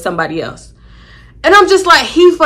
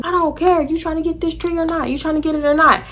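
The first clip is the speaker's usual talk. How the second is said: high mock dealer; 7 semitones higher, 3 dB quieter than usual. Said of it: "He was like".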